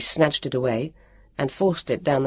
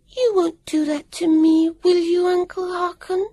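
put-on high, sugary little-girl voice; a high put-on childish plea; little lisping voice; 3 dB louder than usual. A narrator's reading. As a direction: play the innocent little girl, pleading. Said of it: "in a little lisping voice".

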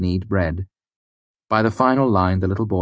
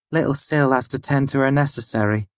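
higher-voiced, mildly apologetic mother; 7 semitones higher than usual. A narrator's reading. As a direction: make a polite, apologetic excuse in a raised pitch. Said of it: "she said apologetically".

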